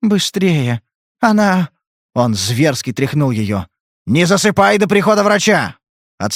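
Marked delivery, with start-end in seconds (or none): 0.0-1.7 high, strained, halting mumble; mumbled. A narrator's reading. Drugged girl urging Spade on, mumbled. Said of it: "она промямлила". 4.1-5.8 high, forceful command; urgent shouted order. A narrator's reading. Spade shaking the girl before the doctor comes, urgent shout.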